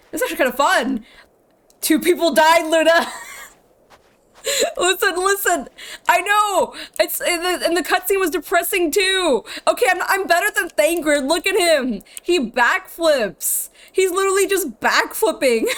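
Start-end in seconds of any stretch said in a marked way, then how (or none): 1.8-3.1 high, loud cry of playful outrage; mock-outraged scolding. Playfully scolding a laughing friend. 4.8-15.7 high-pitched, giggly self-defence; playful protest. Pretend. Defends herself amid laughter.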